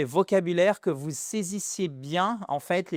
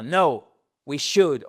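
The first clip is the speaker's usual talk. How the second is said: blunt refusal; 6 dB louder, slower than usual. Shown as a demonstration. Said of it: "vous allez pas dire".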